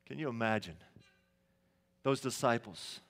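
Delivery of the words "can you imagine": ordinary talk, nothing marked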